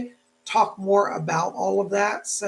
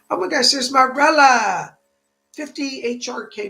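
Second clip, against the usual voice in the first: excited surprise; 4 semitones higher, 7 dB louder than usual. Real, not acted. Spots a donor in chat.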